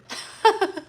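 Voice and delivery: high burst of laughter; laughing. Real, not acted. Laughs at the stupid claim.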